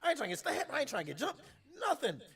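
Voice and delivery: high, loud rapid-fire denials; agitated protest. Retold or imitated. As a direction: protest at the top of your voice. Retelling self defending against friend's warning.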